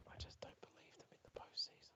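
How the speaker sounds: hushed whisper, like sharing a secret. Acted. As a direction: whisper it, barely voiced. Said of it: "Why, why are we whispering?".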